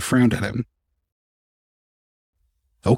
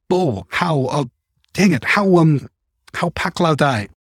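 stammering; 5 semitones higher, slower than usual. A narrator's reading. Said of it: "I stammered".

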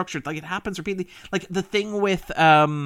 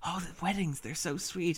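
a much softer, leaning-in murmur; hushed aside; 3 semitones higher, 10 dB quieter than usual. Retold or imitated. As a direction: confide it close, as if only to Greg. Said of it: "leans into Greg and she says".

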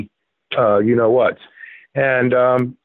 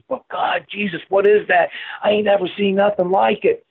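high imitated Southern drawl, astonished; mock Southern awe, pitched up high; 7 semitones higher than usual. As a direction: do the Southern biker gasping at the weed. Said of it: "he had that big Southern drawl".

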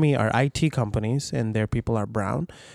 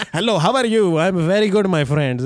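higher, louder mock Indian accent; put-on Indian accent; 6 semitones higher, 5 dB louder than usual. Voice actor giving clients the accent they want, hammed-up Indian accent. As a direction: lay on a cheery Indian accent. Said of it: "Can you do a little bit of accent".

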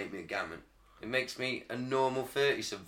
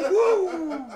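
cheer; 8 dB louder than usual. Real, not acted. Cheering after the rant.

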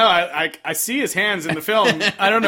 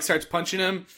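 ordinary delivery

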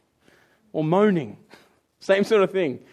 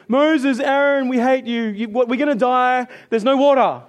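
loud, higher-pitched mock complaint; mock complaining; 4 semitones higher, 5 dB louder than usual. Israelites in the desert complain to Moses and Aaron, mock grumbling. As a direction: gripe like a fed-up crowd. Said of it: "They complained".

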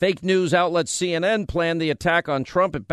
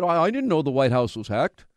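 mocking clueless people. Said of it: "how many people said".

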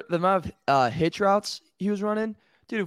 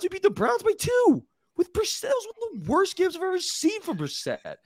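incredulous; 14 semitones higher than usual. Real, not acted. Incredulous about the Browns win.

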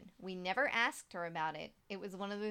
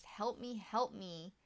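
normal talking voice

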